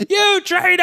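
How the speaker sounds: high-pitched hammed-up outcry; mock accusing cry. Wolf spots a traitor in the crowd, mock accusing cry.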